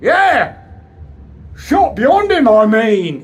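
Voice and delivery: scornful retort. Acted. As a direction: throw his words back at him. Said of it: "Chas was having none of it".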